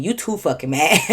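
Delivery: ordinary talk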